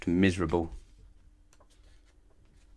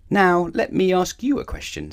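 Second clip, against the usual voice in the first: high, louder, assured; confident challenge; 11 semitones higher, 5 dB louder than usual. A narrator's reading. Mister Dizzy challenging the pig, high and confident. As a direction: turn the tables boldly.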